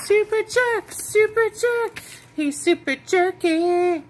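high, loud singsong teasing; teasing baby talk. Pretend. Calling her kitten a super jerk in baby talk. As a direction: tease him way up high, slowly.

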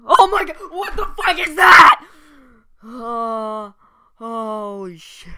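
high, loud fake shriek; scared horror game streamer. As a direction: scream like it's terrifying. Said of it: "have fake reactions".

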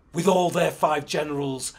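loud, forceful growl. Acted. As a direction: growl it out like a king. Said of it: "Menelik growled".

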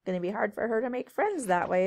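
ordinary delivery, nothing marked

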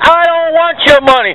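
usual speaking voice

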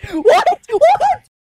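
rising to a very high squeal; real shocked squeak; incredulous. Real, not acted. Disbelief, repeating higher each time.

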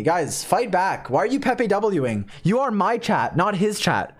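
usual speaking voice

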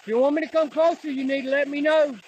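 loud challenge to the spirit; loud call to a ghost in the woods. Real, not acted. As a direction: dare the ghost to answer. Calling out to a spirit.

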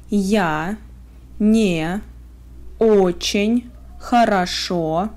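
ordinary talk

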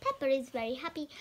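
usual speaking voice

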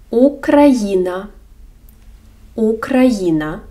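Україна drawn out slowly, у rounded; slow model word. Shown as a demonstration. Teacher models Україна slowly for beginners. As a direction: say Україна slowly, each syllable clear. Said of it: "Наприклад".

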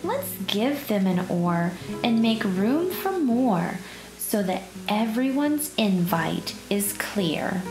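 ordinary delivery, nothing marked

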